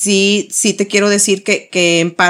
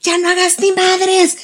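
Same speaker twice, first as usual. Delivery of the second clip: angry snap; 8 semitones higher than usual. Retold or imitated. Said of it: "mother snapping at son".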